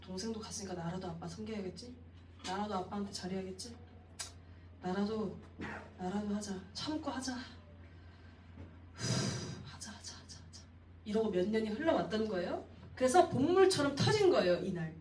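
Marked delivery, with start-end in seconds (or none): 0.0-10.6 softer, sinking into a breathy resigned self-talk; dutiful muttering to himself. Acted. Elder son quietly forcing himself to keep serving, resigned. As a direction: grit your way through duty. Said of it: "의무감으로 섬긴 거예요".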